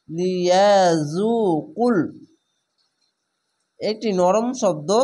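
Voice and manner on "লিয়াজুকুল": লিয়াজুকুল drawn out slowly, rising at the end; slow recitation. Shown as a demonstration. Teacher joining the spelled syllables into লিয়াজুকুল. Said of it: "কফ লাম পেশ কুল".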